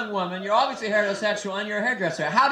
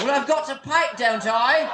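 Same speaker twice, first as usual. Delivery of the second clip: higher, indignant English voice; hammed-up English retort; English accent; 4 semitones higher than usual. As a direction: answer back as a put-on Englishman. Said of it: "How do we know you're from England?".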